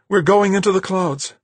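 high, loud, delighted call; happy exclamation. A narrator's reading. Marguerite as the ship heads into the clouds, happily. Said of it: "Marguerite said happily".

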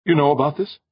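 high, mildly snappish question; snapped question. A narrator's reading. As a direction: snap it as a sharp challenge. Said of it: "Brandon snapped".

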